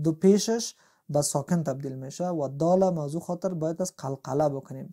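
ordinary talk

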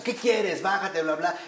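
mock road rage. Angry driver after a cut-off.

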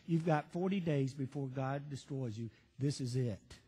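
low and soft, no fire at all; flat, quiet warning. Retold or imitated. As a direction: say the doom plainly and quietly. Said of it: "This is the way he said it".

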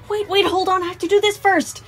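high, hurried; flustered. A narrator's reading. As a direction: fend off the rush. Sun flustered, rushed.

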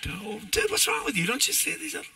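lightly hammed-up, high-pitched exasperation; mock scolding. Preacher mimics scolding the one disobedient kid, high mock exasperation.

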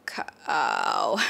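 "Cow" sunk to the bottom of the voice; very low tone. Shown as a demonstration. Teacher exaggerating how low the tone goes, very low "Cow". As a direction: slide "Cow" down into a very low drone. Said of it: "and then go even lower".

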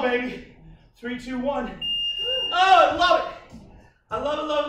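normal talking voice

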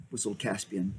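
plain reading voice

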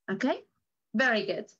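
normal talking voice

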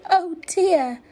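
high, loud dismayed oh dear. A narrator's reading. Rabbit sees the ruined house, dismayed. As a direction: sigh it out over the wreck.

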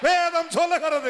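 high, slow shout; stretched-out preacher's cry; full-force preaching. Real, not acted. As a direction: announce the scripture to the whole crowd. Preacher proclaims scripture at full force.

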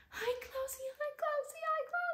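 tiny, very high squeaky coo; puppy-greeting voice. Retold or imitated. Greeting the puppy in his crate, high squeaky baby talk.

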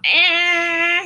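"Eh" pinched and tight from a closed throat; tense throat. Shown as a demonstration. Band teacher shows students the strained, tense "Eh". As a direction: squeeze "Eh" out through a tense throat. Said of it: "I'm gonna go".

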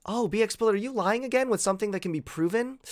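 ordinary talk

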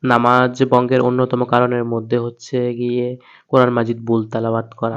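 usual speaking voice

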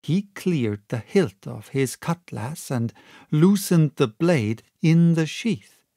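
usual speaking voice

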